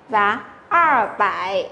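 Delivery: 二百 said very slowly with a curled-tongue er; slow erhua demo. Shown as a demonstration. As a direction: stretch out 二百, tongue rolled back. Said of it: "đọc uốn lưỡi". (二百 đọc chậm, uốn lưỡi)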